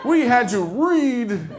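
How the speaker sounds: hammed-up old man reminiscing, slow and loud. Comedian mocking himself as an elder recalling dating, hammed-up old man. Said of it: "I already feel like an old man".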